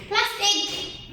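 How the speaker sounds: snapped out sharply and high; angry shout. Retold or imitated. Retelling a cashier demanding plastic with anger. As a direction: bark it like an irritated cashier. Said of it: "With that anger".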